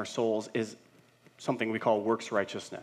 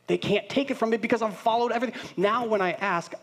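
excited; 11 semitones higher, 3 dB louder than usual. Pretend. Triumphant confidence.

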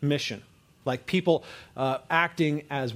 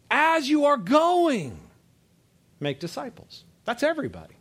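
rising, louder and higher preaching; preaching at full force; 6 semitones higher, 4 dB louder than usual. Acted. Preacher urging the congregation that everyone must be going, preaching hard. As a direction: build the fire, hammering the point home. Preacher drives home his point.

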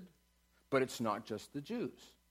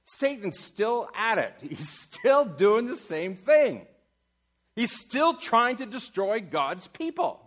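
loud, high, slow preacher's proclamation; fiery sermon delivery; preaching at full force; 9 semitones higher, 8 dB louder, slower than usual. Acted. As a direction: proclaim it to the whole room.

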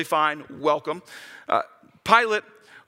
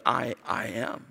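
solemn low declaration; 9 semitones lower, 5 dB quieter, slower than usual. Acted. Jesus voiced, low and slow.

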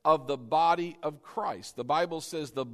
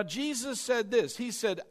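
ordinary talk

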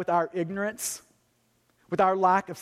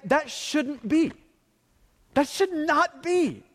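slow and higher, stressing that it should not be; emphatic plea; 5 semitones higher, slower than usual. Real, not acted. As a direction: plead slowly, lifting the pitch on the repeat. Pastor's passionate appeal on diversity.

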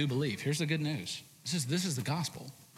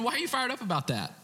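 much higher, incredulous scolding questions; Paul scolding; 11 semitones higher than usual. Retold or imitated. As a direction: needle them for getting proud. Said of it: "he's saying".

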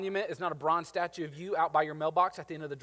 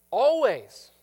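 ALWAYS hit hard and loud; always, loud and high; stressed always; 5 semitones higher, 10 dB louder than usual. Real, not acted. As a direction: punch the word always. Emphatic sermon point.

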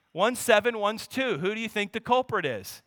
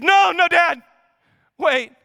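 put-on higher, louder child's cry to stop; urgent little boy; 8 semitones higher, 7 dB louder than usual. Son rushing to stop his dad, loud and high. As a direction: plead urgently like a small kid.

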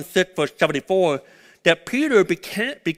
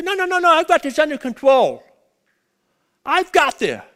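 mock denial; 10 semitones higher, 4 dB louder than usual. Voicing a denying listener.

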